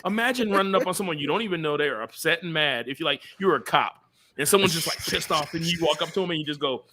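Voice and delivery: normal talking voice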